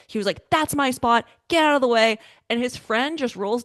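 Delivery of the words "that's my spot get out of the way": mock angry shout, higher and louder; retold angry yell. Driver snapping at a rival over a parking spot, mock angry yell. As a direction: blurt it like a stranger fighting over a parking spot. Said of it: "immediately just lost his shit".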